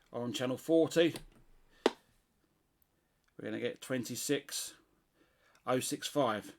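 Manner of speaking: ordinary delivery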